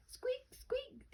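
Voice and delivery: tiny squeaks, very high and soft. A narrator's reading. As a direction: squeak from inside the egg. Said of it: "the egg started to crack".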